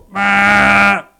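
loud, held buzzer blare; game buzzer. Retold or imitated. Preacher telling the end of the game, loud imitated buzzer. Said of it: "you hear the buzzer go".

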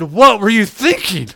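exasperated scolding. Retold or imitated. [name] to friend after the danger, exasperated scolding. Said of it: "And I said".

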